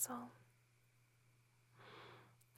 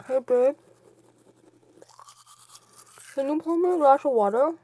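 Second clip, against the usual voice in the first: loud, raised to carry across the room; calling out; 4 semitones higher, 12 dB louder than usual. Acted. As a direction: call across the house.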